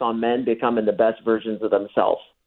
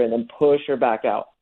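usual speaking voice

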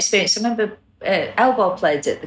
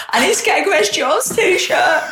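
slow, high, eager inner voice; eager quote; 10 semitones higher than usual. Retold or imitated. Fan telling a friend her own keen thought, higher and slower.

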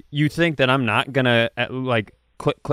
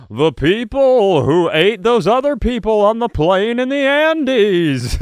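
SNL announcer voice; 9 semitones higher, 6 dB louder than usual. Retold or imitated. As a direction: announce it like a late-night promo. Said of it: "announcer voice".

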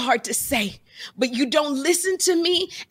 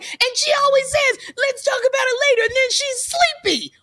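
put-on much higher, slightly louder whiny kid voice; 13 semitones higher, 3 dB louder than usual. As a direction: complain like a put-upon child. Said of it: "He's like".

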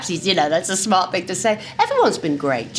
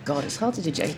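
ordinary talk

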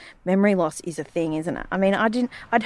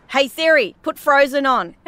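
higher, louder demand; snappy command to Siri; bossing Siri; 6 semitones higher, 4 dB louder than usual. Shown as a demonstration. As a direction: order the phone around. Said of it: "Siri's a bitch".

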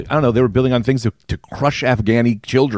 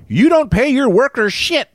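high-pitched indignant retort; mock outrage; 13 semitones higher than usual. Member of the public confronts billionaire, high mock outrage. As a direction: pitch it way up in acted disbelief.

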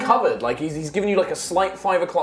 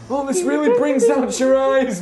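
higher, louder mock-flattering hero voice; Aquaman voice; 9 semitones higher, 4 dB louder than usual. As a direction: gush it like a vain hero, pitched up. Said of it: "Aquaman was just like".

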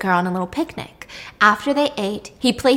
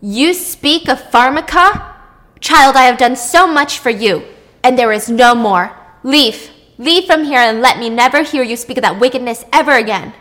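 enraged goddess; 2 semitones higher, 6 dB louder than usual. Acted. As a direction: banish her in a cold fury. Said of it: "set Tethys into a world of rage".

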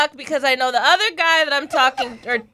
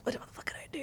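whisper; 21 dB quieter, much faster than usual. Retold or imitated. Panicked aside at the party.

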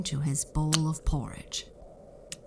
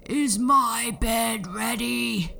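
slow, loud, higher grumbling giant; giant growling; 7 semitones higher, 5 dB louder, slower than usual. Acted. Giant demanding his warm bed, slow loud growl. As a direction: growl it out slowly like a sleepy giant. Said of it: "the giant would growl".

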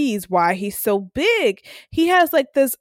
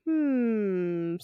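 pondering hmm; 9 dB quieter than usual. Retold or imitated. Suspicious reaction to cryptic last words.

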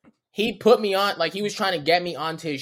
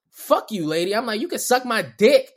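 retold cussing out; 6 semitones higher, 5 dB louder than usual. Cussing out Dunkin' Donuts lady.

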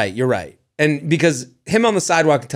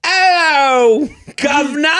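high hammed-up Cockney greeting; mock Cockney; 10 semitones higher, 3 dB louder, slower than usual. Joking about London listeners, exaggerated Cockney hello. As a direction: play a Mary Poppins Londoner in Cockney. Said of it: "That's exactly how they say it".

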